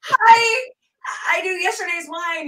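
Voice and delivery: high, loud, panicked hello; flustered starstruck gush. Retold or imitated. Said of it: "I was like".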